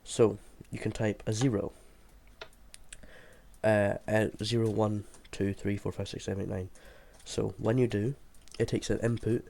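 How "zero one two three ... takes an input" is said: usual speaking voice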